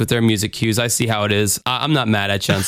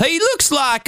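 high, slow Southern drawl; hammed-up Southern accent; 11 semitones higher, slower than usual. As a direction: stretch it out with a comic Southern twang. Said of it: "got a little bit of Southern accent".

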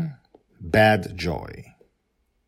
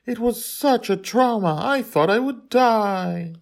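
much higher, slow, put-on princess voice; a fussy princess milking her ordeal; dramatic princess; 5 dB louder than usual. A narrator's reading. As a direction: princess telling the father about her ordeal, high and dramatic.

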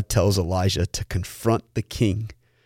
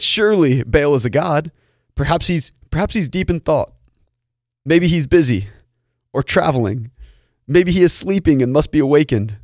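mock-sincere suggestions, pitched higher; taunting; 5 semitones higher, 4 dB louder than usual. A narrator's reading. Elijah mocks the prophets' silent god, taunting. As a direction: needle them with high-pitched sarcastic guesses. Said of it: "he began to taunt them".

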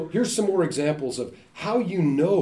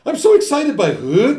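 mock excitement; 10 semitones higher, 9 dB louder than usual. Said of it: "My wife was harassing me".

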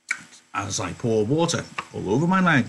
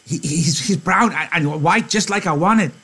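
put-on higher, halting childlike voice; breathless stammering Lenny, pitched up; 6 semitones higher than usual. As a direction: stammer it out eagerly, like a big kid. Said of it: "Lenny said breathlessly".

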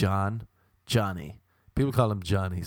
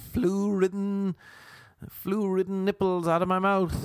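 silly mock voice; 8 semitones higher than usual.

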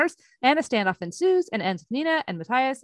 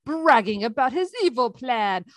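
ordinary delivery

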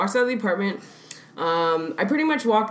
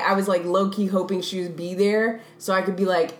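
usual speaking voice

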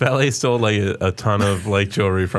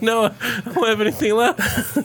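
much higher, whiny plea; high pleading voice; 11 semitones higher than usual. Retold or imitated. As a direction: squeak it out desperately. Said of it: "I'd be like".

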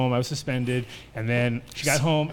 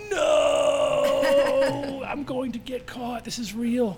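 panicked inner voice; 11 semitones higher, slower than usual. Retold or imitated. Teen in the principal's office panicking at being caught. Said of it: "I'm instantly like".

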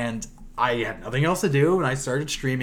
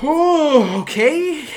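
startled okay; 12 semitones higher, 9 dB louder, much slower than usual. Retold or imitated. Awkward reaction to kid.

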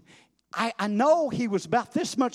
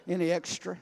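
ordinary delivery

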